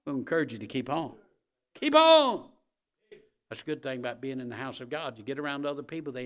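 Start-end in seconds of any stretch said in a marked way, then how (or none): 1.7-2.5 high, very loud urging; full-voice rallying shout; shouted exhortation. Real, not acted. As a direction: holler encouragement to the congregation. Preacher urging congregation to persevere.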